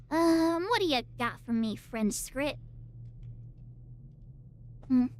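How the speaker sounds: ordinary talk, nothing marked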